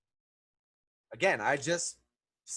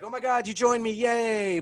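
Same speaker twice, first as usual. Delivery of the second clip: higher, louder gushing glee; mock delight; 7 semitones higher, 6 dB louder than usual. Picard greeting his new ally, mock-overjoyed. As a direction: squeal a hammed-up happy welcome. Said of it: "also shocked 'cause he's like".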